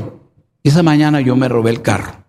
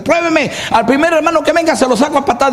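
much higher taunting challenge; defiant threat; 10 semitones higher than usual. Retold or imitated. Son daring his mother to test him, high and taunting. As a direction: throw down the challenge.

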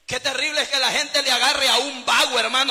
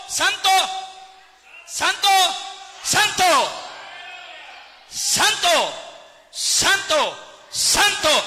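raised, higher voice on each cry; 5 semitones higher, much slower than usual. Acted. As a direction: call out each word separately, like a heavenly chorus. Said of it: "dando voces diciendo". (dando voces, con clamor)

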